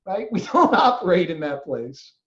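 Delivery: ordinary talk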